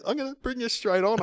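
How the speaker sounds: high-pitched mock tearful voice; squeaky hammed-up weeping; bawling. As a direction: imitate a host choking through tears. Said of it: "he's bawling".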